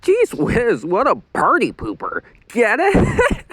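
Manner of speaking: high, gleeful jokey voice; teasing prankster. A narrator's reading. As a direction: crack the joke with glee. Luann joking.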